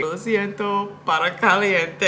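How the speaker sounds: slow, laughing broken Spanish; mock Spanish. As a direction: apologize in jokey tourist Spanish. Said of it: "and I was like".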